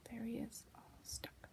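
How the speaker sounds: very soft, unvoiced whisper; hushed narrator's whisper; whispered aside. A narrator's reading. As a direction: confide it secretly to the listener.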